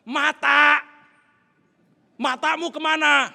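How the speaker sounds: imitating a furious yell, high and very loud; angry rider's shout. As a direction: shout accusingly like an outraged motorbike rider. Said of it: "Kok jadi dia yang marah?". (bentakan marah, menirukan pengendara motor)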